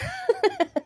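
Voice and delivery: high-pitched burst of laughter. Real, not acted. Vlogger reacting to an embarrassing clip, high helpless laugh. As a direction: break off into a high giggle.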